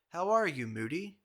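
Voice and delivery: loud, high, back-slapping hello; hearty greeting. Retold or imitated. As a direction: greet an old friend boisterously. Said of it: "with a slap on the back".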